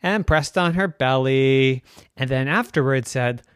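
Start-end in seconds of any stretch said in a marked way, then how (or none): none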